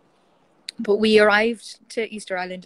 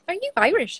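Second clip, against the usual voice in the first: higher, louder retold question; surprised question; 11 semitones higher, 6 dB louder than usual. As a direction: imitate a delighted stranger spotting a compatriot. Retold surprise at meeting compatriot.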